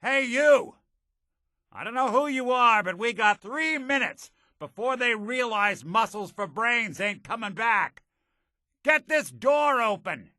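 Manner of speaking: high-pitched urgent holler; urgent calling out. Acted. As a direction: call out to a stranger to get you out. Calling urgently through a locked door.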